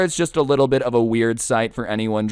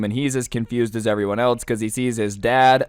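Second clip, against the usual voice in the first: ordinary talk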